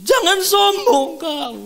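thundering divine rebuke, high and slow; loud, drawn-out, high-pitched scolding; Roh Kudus. Retold or imitated. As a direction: cry out each word as a stern reproof. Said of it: "kata suara itu".

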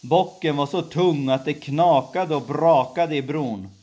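plain reading voice

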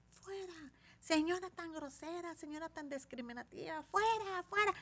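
high, soft mock jeering; indignant crowd. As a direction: imitate onlookers chanting someone out, without full volume. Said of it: "Hubieras visto a la gente".

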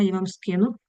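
ordinary talk, nothing marked

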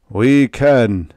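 loud ragged crowd reply; ragged chorus. A narrator's reading. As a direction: answer like many voices at once. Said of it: "The answer came in chorus".

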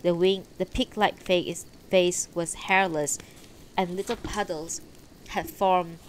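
ordinary delivery

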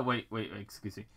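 ordinary talk

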